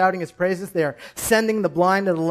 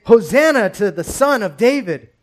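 louder, higher cry of praise; 4 semitones higher, 8 dB louder than usual. Acted. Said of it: "the children crying out".

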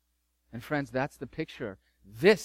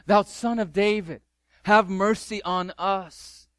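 louder and higher, pleading, slow on the last words; desperate cry; 5 semitones higher, 6 dB louder than usual. Acted. Blind men calling to Jesus, desperate loud plea. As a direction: cry out for mercy over the crowd. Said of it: "they cry out all the more".

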